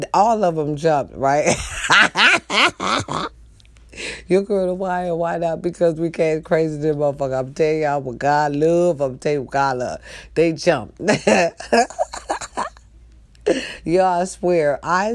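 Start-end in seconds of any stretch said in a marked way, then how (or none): 1.6-3.3 big burst of cackling laughter; hearty laugh. Real, not acted. Storyteller amused that they all jumped, hearty cackle. 11.0-12.7 rolling cackle of amusement; hearty laugh. Real, not acted. Storyteller remembering that they jumped, hearty cackle.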